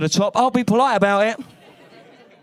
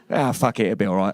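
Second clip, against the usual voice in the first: careless shrug; 9 semitones lower, faster than usual. Retold or imitated. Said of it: "my reaction when someone shows me".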